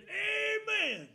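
loud, high congregation call-outs; excited church crowd shouting back; shouted amens. Retold or imitated. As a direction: holler it back at the preacher like the whole church. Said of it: "the whole church was saying".

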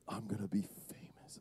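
low, whispery aside; hushed glee. Acted. Friend recording the ghost on a phone, hushed.

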